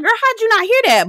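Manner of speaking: high-pitched mock disbelief; incredulous. Host asking how she missed the news, mock incredulous. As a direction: question her in an exaggerated high voice. Host's acted disbelief at Noelle.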